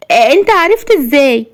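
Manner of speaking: high-pitched astonished question; stunned. A narrator's reading. Woman shocked that he knows about the baby, stunned. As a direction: blurt it high, in disbelief. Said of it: "وقالت بذهول".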